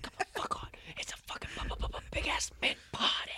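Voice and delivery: forceful, hyped-up stage whisper; energetic whisper. Shown as a demonstration. Urging friend to put energy into whispers, forceful whisper. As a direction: whisper with pep-talk energy. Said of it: "energy into your damn whispers".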